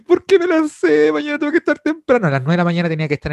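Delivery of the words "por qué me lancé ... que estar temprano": imitated crying lament. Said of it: "llorando en el baño".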